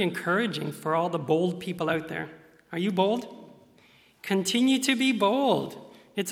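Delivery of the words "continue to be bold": ordinary talk, nothing marked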